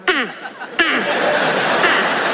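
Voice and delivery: high, laughing chimp hoots. Pretend. Chimp noises while holding hands.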